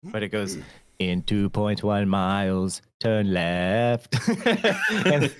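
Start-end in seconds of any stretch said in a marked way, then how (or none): 1.0-4.1 slow, low-pitched navigation voice; imitated satnav, measured and low; GPS voice. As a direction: read it out slowly like a GPS giving directions. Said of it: "the voice she has on her G P S".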